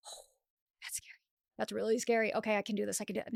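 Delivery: hushed, breathless fright; scared whisper. Acted. Skydiver at the plane door, whispering in fear.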